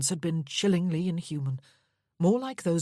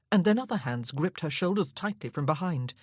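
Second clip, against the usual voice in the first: ordinary delivery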